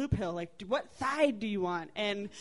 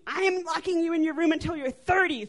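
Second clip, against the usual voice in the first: high, loud parental threat; mock angry mother; 7 semitones higher, 5 dB louder than usual. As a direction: lay down the punishment, voice raised. Retold mother's angry punishment.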